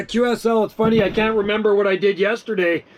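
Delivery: normal talking voice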